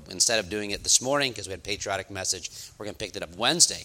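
ordinary talk

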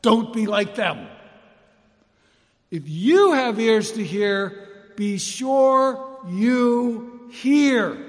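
loud, high fervent preaching. Acted. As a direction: preach it at full force. Preaching at full force.

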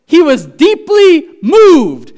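high, loud and slow, every word stretched; thundering proclamation. Acted. Loud preaching on Jesus' anger.

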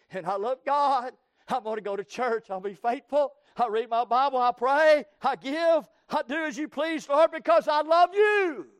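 rising, shouting devotion to God; preaching at full force. Acted. Preacher voicing a believer's vow to God, building loud.

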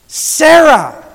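Sarah rung out loud and high; emphasis on Sarah. Shown as a demonstration. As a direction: lay heavy emphasis on Sarah, standing alone. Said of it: "There's an emphasis that needs to be laid".

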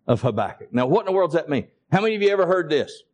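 normal talking voice

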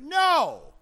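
high, loud exclaimed answer; emphatic refusal. Acted. Preacher answering as Eastern mystics, emphatic refusal. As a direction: belt out the answer as a pulpit shout.

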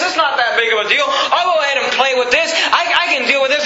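high, fervent warning in God's words; preaching at full force. Acted. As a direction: press the warning on the sinner. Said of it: "he said".

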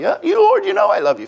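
very high-pitched protesting reply; high, pleading mock reply; Peter. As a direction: answer in a squeezed, high acted voice. Acts out Peter answering the Lord.

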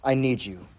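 soft confession. Retold or imitated. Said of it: "It's like saying".

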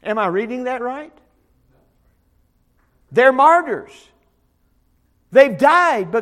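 ordinary delivery

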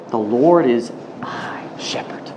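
slow breathy whisper of the verse; whispered psalm. Acted. Preacher quoting the psalm, whispered slowly. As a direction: breathe the line out like a secret.

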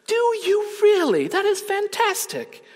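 imitated delighted amazement. Woman hearing of his programs, high excited imitation. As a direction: gush with sky-high pitch. Retold woman growing more impressed.

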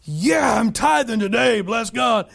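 hammed-up boastful giver; showy tithing. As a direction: shout it like a proud showoff. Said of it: "this, like".

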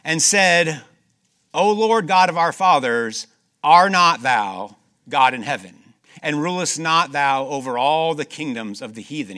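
loud, ringing scripture reading; preaching at full force. Acted. Preacher reads a king's prayer aloud, loud and ringing. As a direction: proclaim the king's prayer to the congregation.

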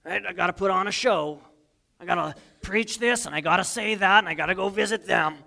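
self-important preacher; pompous pastor voice. Retold or imitated. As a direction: puff up like a showy pastor. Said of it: "I'm like".

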